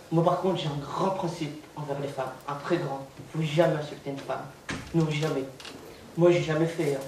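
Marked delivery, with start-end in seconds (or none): none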